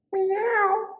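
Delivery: very high, drawn-out meow; little cat. A narrator's reading. Cat answers the little witch, high meowing. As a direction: mew high like a small cat. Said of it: "the little cat said".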